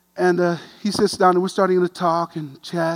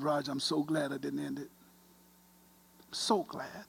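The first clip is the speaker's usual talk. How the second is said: very soft, holding back tears; choked up; 2 semitones lower, 14 dB quieter than usual. Real, not acted. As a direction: voice sinking away as tears well up. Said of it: "This tear thing starts happening".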